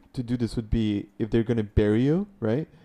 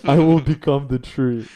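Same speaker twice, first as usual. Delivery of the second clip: grand proclamation; 3 semitones higher, 7 dB louder than usual. Pretend. Mock grand tree proclamation.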